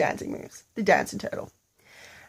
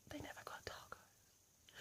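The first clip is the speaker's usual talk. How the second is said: hushed whisper, slowed at the end; soft conspiratorial whisper; whispered aside; 35 dB quieter than usual. A narrator's reading. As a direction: let the audience in on a secret. Aside about Hoot's sass.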